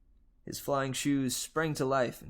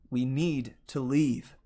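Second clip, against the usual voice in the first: firm; 4 dB louder, slower than usual. Acted. Urging a dazed friend out.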